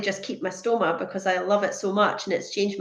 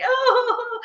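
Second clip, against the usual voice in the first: long high-pitched "oh"; imitating a sobbing wail, hammed-up; mock tearful wail. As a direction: let out a drawn-out mock cry. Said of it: "I was in floods of tears to her".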